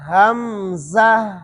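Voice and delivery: ہمزہ drawn out very slowly, the ha kept audible; ہمزہ stretched, with voice and breath flowing through the ha; slow careful hamza. Shown as a demonstration. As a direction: sound out ہمزہ slowly, letter by letter. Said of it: "زا کے بعد ہا کو ظاہر کرنا ہے".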